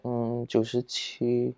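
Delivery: soft, slow, reluctant reply; timid answer. Retold or imitated. Child answering her angry mother, soft and slow. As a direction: mumble it as if dreading the answer. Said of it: "然后我讲".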